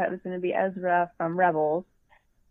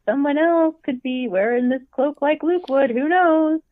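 mock skeptical; 6 semitones higher, 4 dB louder than usual. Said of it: "And I'm like".

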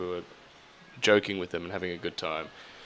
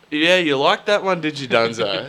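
teasing jab; 5 semitones higher, 7 dB louder than usual. Retold or imitated. Teasing partner on stage.

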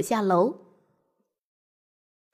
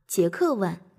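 plain reading voice

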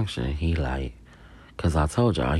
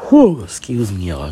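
ordinary talk

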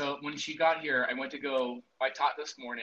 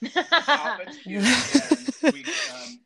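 laughing; 12 semitones higher, 13 dB louder than usual. Real, not acted. Laughing, high and loud.

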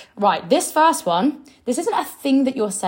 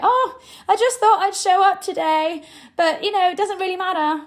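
high-pitched, offhand imitation; mock hobbyist; 8 semitones higher than usual. Coach mimicking sellers who make business sound unimportant, high mock-casual voice. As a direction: play it down in a high, airy mimicry. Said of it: "You're making it sound like a hobby".

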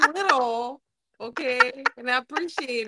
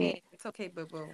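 mock soothing; 6 semitones lower, 7 dB quieter, faster than usual. Mocking soothing tone.

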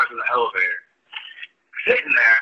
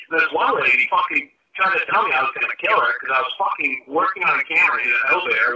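ordinary delivery

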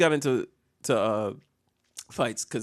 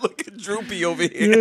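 mocking bully; 5 semitones higher than usual. Mocking schoolmates.

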